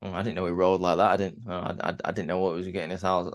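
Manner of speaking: lightly voiced nervous backtrack; mock flustered. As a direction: trip over the words like you got caught out. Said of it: "Neiman Price, like".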